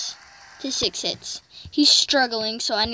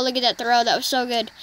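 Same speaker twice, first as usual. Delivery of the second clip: usual speaking voice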